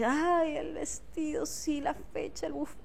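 lightly mocking wedding-obsessed bride; mock dreamy bride. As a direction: parody a girl dreaming of her wedding.

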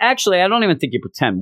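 normal talking voice

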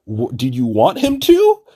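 loud, incredulous question shooting up high at the end. Retold or imitated. Bystander to the chick taunting the dude, loudly incredulous. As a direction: act out baffled disbelief, voice leaping upward.

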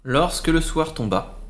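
ordinary delivery

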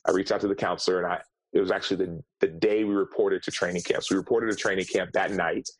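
usual speaking voice

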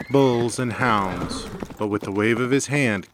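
ordinary delivery, nothing marked

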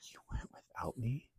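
whispered gasp of mock shock; mock betrayed. Hearing her friend saw Kalani, whispered mock outrage. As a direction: gasp and whisper it, pretend hurt.

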